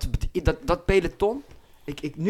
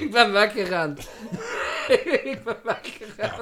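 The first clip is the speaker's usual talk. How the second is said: high, helpless laughing through the words; cracking up while talking; real laughter; 6 semitones higher, 3 dB louder than usual. Real, not acted. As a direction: barely get it out for laughing. Cracking up at own story.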